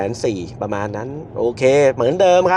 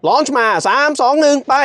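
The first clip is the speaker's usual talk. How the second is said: excited countdown; 13 semitones higher than usual. Real, not acted. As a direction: call the countdown with rising thrill. Third launch countdown. (นับถอยหลังอย่างตื่นเต้น)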